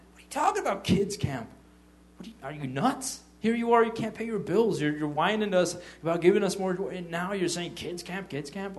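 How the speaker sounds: exasperated disbelief, pitched high and pressed; incredulous scolding. Acted. Berating someone unable to pay bills over kids camp, incredulous scolding. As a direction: scold them as if they've gone nuts.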